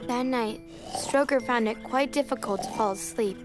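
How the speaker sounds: gentle storybook narration; reading to a toddler. A narrator's reading. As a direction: read it like a bedtime story.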